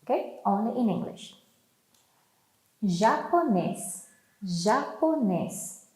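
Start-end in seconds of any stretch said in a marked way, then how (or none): 2.8-5.8 "Japonês" said slowly, syllable by syllable; patient teacher model of "Japonês"; slow model word. Shown as a demonstration. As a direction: draw out "Japonês" for the class. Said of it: "teacher models vocabulary".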